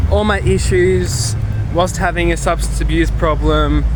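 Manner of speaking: ordinary delivery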